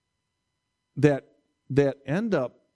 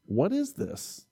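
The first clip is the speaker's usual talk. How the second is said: confused wonder; 4 semitones higher, 5 dB quieter than usual. Retold or imitated. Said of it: "They were confused".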